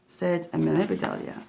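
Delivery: usual speaking voice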